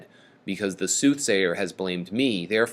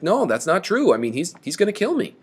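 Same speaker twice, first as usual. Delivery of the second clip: higher, hurried mock protest; mock whining; 8 semitones higher than usual. Creon protesting to the queen that he'll be killed, mock whine. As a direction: whine it lightly, pitched up. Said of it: "And then they whine about it".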